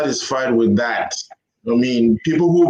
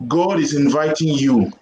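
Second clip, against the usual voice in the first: ordinary delivery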